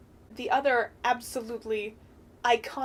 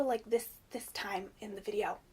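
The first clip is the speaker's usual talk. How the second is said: whisper; 7 dB quieter than usual. Real, not acted. Pointing viewers ahead, hushed.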